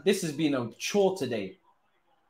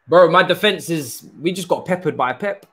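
frustrated outburst; 5 semitones higher, 9 dB louder than usual. Real, not acted.

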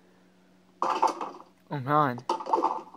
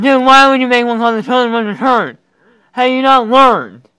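mock scolding; 6 semitones higher, 12 dB louder than usual. Scolding a game character.

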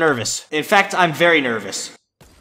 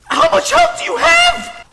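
very high, slow squealing disbelief; disbelieving squeal; slower than usual. Real, not acted. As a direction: shriek it in disbelief. Shocked at boss health.

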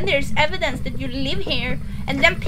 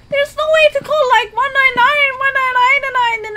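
high, near-crying shout; panicked cry; 8 semitones higher than usual. Real, not acted. Panic after friend dies in game.

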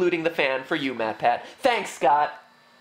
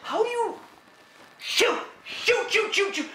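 frustrated; 11 semitones higher than usual. Real, not acted. Just lost the night.

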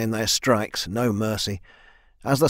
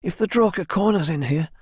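higher-pitched, worried voice; urgent warning; 7 semitones higher than usual. A narrator's reading. Swordswoman warning the leader of danger, urgent. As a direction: warn him, pitched up.

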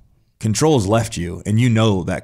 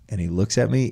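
ordinary talk, nothing marked

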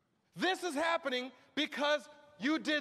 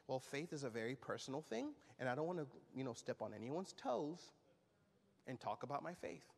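lower, much softer, fast mocking believer; 11 semitones lower, 11 dB quieter, faster than usual. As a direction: brush it off like a timid churchgoer. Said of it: "most believers like to say".

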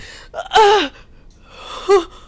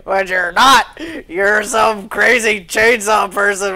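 lower, defiant taunting of the monster; mock bravado; 8 semitones lower, 4 dB quieter than usual. Real, not acted. Player taunting the chainsaw killer, defiant low bravado. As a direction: puff up and pretend not to be scared.

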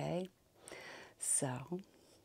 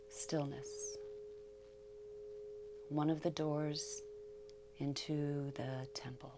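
calm verse, fading softer towards the end; meditative poem reading; 3 semitones higher, 7 dB quieter than usual. Acted. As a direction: read the poem softly, letting each phrase rest.

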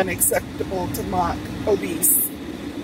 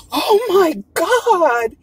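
drawn-out, higher-pitched gawking exclamation; mock shock; 7 semitones higher, 4 dB louder, much slower than usual. Woman gawking at the speaker's size, mock shock. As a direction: mimic a stranger's loud, stretched-out disbelief. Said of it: "grown woman even one time go".

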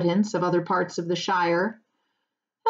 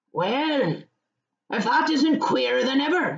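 higher, drawn "Well" in rustic surprise; astonished exclamation; 6 semitones higher than usual. Acted. Farmer hearing the name Baggins, astonished exclamation. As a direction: exclaim like an amazed countryman. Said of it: "he exclaimed".

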